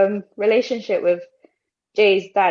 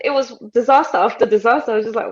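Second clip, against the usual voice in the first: usual speaking voice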